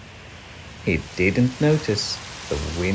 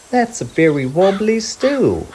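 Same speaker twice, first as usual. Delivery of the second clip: high, playful mum searching; Mum's voice; 11 semitones higher, 5 dB louder than usual. Acted. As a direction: pretend you can't find him. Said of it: "Mum searching".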